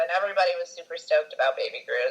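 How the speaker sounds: usual speaking voice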